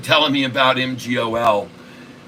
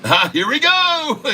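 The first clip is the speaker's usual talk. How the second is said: high voice; 14 semitones higher than usual. Pretend. Winding up LeBron impression.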